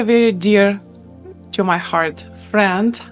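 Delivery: normal talking voice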